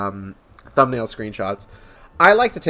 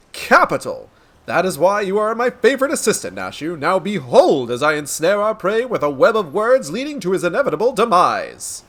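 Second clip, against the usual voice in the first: grandiose detective declaiming to an audience; theatrical, florid gentleman sleuth; pompous inspector; 6 semitones higher than usual. Acted. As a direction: proclaim it like a self-important hero. Inspector character.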